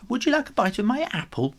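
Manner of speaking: high, quick offer; eager kitten. A narrator's reading. Kitten offering Tiddles an apple, high and fast. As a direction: chirp it out eagerly.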